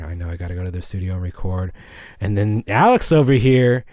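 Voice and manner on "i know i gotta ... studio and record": burnt out mumble. Retold or imitated. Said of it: "I'm just burnt out".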